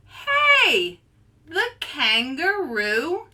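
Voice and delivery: high, loud outraged cry; shocked. Acted. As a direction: yelp at the unfair sign. Carlos shocked at the sign.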